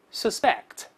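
"suspect" read as a verb, weight on the -spect syllable; verb reading, stress on second syllable. Shown as a demonstration. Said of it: "跟老师大声读一遍". (动词suspect，重音在后)

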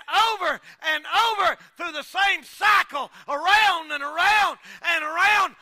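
loud, high, rising preacher's cry; shouting sermon climax; preaching at full force. Acted. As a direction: preach it hard to the congregation.

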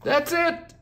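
very high and loud burst; a unicorn suddenly understanding; triumphant that's it; joyful exclamation. Acted. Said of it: "exclaimed Alchemy".

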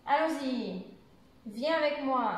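exclamation lingering on the ends of ALLONS-Y and MOI; exclamative intonation. Shown as a demonstration. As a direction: stretch the final "y" of "allons-y" and "moi".

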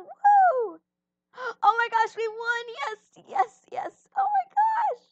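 high, loud victory screams; excited shouting. Real, not acted. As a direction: scream with joy after winning. Just won the game.

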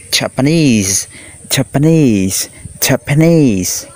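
"Japanese" drawn out, falling at the end; model pronunciation. Shown as a demonstration. Teacher asks pupils to listen and read along, drawn-out model pronunciation. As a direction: say "Japanese" slowly for pupils to repeat. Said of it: "chúng ta đọc theo ha".

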